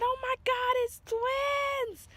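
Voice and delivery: high, loud shriek of joy; excited scream. Retold or imitated. Mother screaming at the ultrasound screen. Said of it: "So I screamed".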